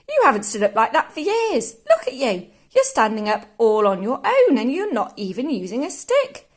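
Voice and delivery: put-on loud, amazed young boy; excited cry. A narrator's reading. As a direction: marvel loudly at what you see. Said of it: "George cried".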